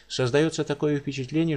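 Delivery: ordinary delivery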